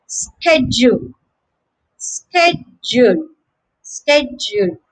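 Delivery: slow SKED-jool; American "schedule", drawn out as SKED-jool; American pronunciation. Shown as a demonstration. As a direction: say "schedule" slowly with an sk start and a j sound. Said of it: "in American English".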